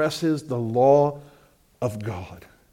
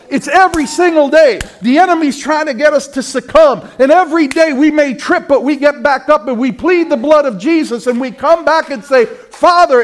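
higher and louder, a fervent pulpit cry; full-force preaching; 10 semitones higher, 8 dB louder than usual. Acted. Preacher rallies the congregation to fight sin, full force. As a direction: preach it like a battle call.

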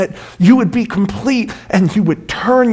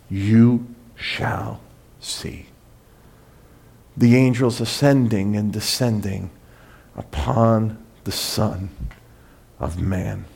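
whispered, slow, fading away; hushed awe; 8 semitones lower, 9 dB quieter, slower than usual. Acted. Preacher closing the passage in hushed wonder. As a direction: let it fall to a breathless hush. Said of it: "climax of the sermon".

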